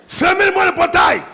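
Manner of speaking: high, loud hammed-up command; furious husband shouting at the household; mock angry order. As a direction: bark it like an enraged husband. Angry man orders gate shut.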